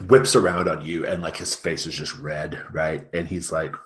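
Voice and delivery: ordinary talk, nothing marked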